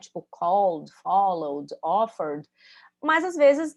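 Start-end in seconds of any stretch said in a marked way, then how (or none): 0.3-2.5 called, followed, offered each end in a plain d, no E. Shown as a demonstration. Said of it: "o som é de D no final".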